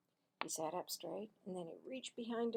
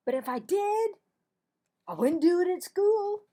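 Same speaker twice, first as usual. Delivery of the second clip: loud protest; defensive boy; 10 semitones higher, 13 dB louder than usual. Acted. Marvin to Cassie, loud defensive denial. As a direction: deny it hotly.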